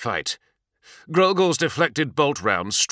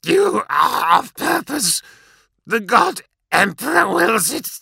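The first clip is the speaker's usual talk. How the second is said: death rattle; 12 semitones higher, 6 dB louder than usual. A narrator's reading. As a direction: force it out through blood. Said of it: "blood bubbling in his throat".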